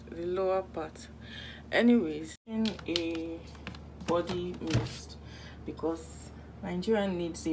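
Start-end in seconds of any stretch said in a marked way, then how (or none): none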